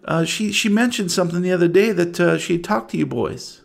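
a hint of anxious small-town woman; worried mother. Game master voices worried mother.

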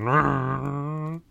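rumbling engine imitation; motorcycle noise. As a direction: growl like a chugging motorbike. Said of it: "They're going".

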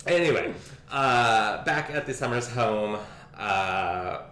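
usual speaking voice

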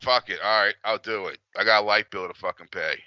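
usual speaking voice

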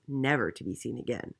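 usual speaking voice

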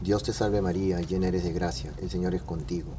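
softly murmured Hail Mary; quiet prayer. Acted. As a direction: pray it softly, almost to yourself.

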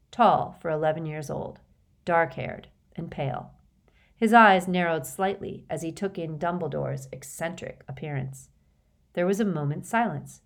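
ordinary delivery

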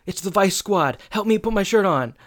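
high, frantic plea; mock panic, pitched well up; panicked Corey. Corey panicking as the door bangs, high and frantic.

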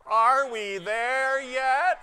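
loud, high, slow group whine; whiny voice. Acted. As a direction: whine it together, as whiny as possible. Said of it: "as whiny a voice as you can muster".

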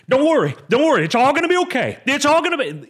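loud, high-pitched panicky yelling; mock-frantic shout far above normal pitch; frantic reassurance. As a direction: burst in shrieking hurried comfort, hammed-up. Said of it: "You don't hear the Lord speaking to you like that".

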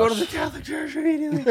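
high, frantic mock warning; hammed-up high-pitched urgency; mock alarm. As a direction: squeal it like a panicked know-it-all. Voicing alarmist internet commenters.

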